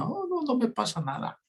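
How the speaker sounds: high mock denial from a crying woman; squeaky "I'm fine" through tears, hammed up; tearful denial. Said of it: "como dice toda mujer cuando está llorando".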